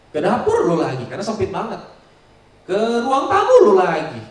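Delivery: loud, high, slowed complaint; exasperated complaint. Retold or imitated. Family member in a tiny house, loud exasperated grumbling. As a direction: complain loudly about bumping into them everywhere.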